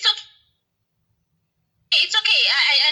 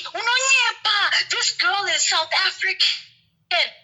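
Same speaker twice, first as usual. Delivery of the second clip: mock shout; 7 semitones higher, much slower than usual. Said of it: "everyone was saying kuti".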